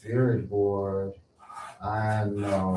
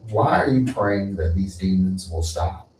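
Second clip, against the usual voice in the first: normal talking voice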